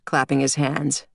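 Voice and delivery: ordinary delivery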